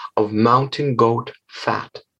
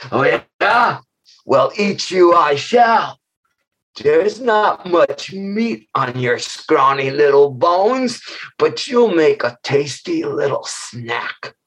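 slow, drawn-out gloating growl; menacing monster; slower than usual. Acted. Monster threatening to eat Beaver Face, slow gloating. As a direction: savor the threat like a hungry giant. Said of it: "this huge monster".

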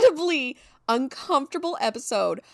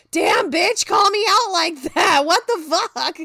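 higher and louder, called-out indignation; mock outrage; 8 semitones higher, 10 dB louder than usual. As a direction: act stung and loudly indignant. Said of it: "And I'm like".

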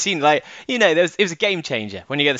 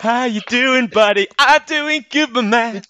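put-on cool kid voice; 10 semitones higher, 4 dB louder, slower than usual. Mock cool kids greeting.

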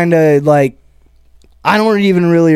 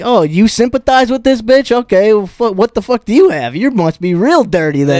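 higher-pitched acted indignation; anger over humor; 7 semitones higher than usual. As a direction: challenge the heckler with mock outrage. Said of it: "more anger than humor".

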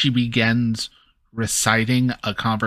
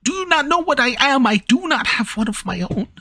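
high, fast anxious Kenku; faster than usual. Acted. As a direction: plead as a mimic bird. Kenku voicing NPC.

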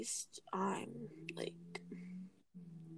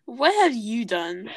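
much louder, indignant comeback; loud retort; 13 dB louder than usual. Pretend. Co-host firing back in a playful quarrel, loud retort. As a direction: fire it back at full volume.

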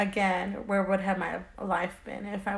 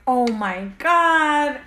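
slow retold dismay; 3 semitones higher, 6 dB louder, much slower than usual. Despair at pregnancy.

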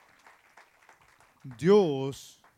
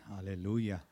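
usual speaking voice

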